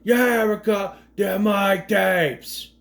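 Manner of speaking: imitated Boston-New York accent, slowly drawn out; mock New York accent. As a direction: drag out the line with a put-on New York twang. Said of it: "I don't know what accent he's trying to do".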